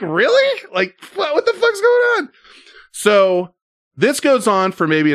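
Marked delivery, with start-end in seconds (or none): 0.0-2.3 high, stunned "what is going on"; incredulous disbelief. Retold or imitated. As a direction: act like you can't believe what you're seeing. His own stunned reaction, retold.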